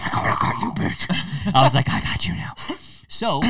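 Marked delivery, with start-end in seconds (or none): 0.0-1.5 gleeful whispered I got you; triumphant whisper; whispered gotcha. Retold or imitated. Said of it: "I said it on camera". 1.9-2.5 whispered gotcha. Retold or imitated. Triumphant catch, retold.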